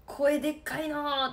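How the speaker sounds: lightly voiced old farmer teasing; a mild gruff complaint about her loud voice; grumbling old man. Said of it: "って言われて". (おじさんの軽いぼやき真似)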